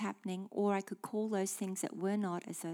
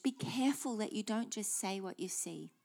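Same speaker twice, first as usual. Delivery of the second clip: usual speaking voice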